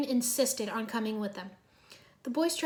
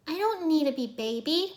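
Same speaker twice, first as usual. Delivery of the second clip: protesting; 6 semitones higher than usual. A narrator's reading. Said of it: "Irene protested".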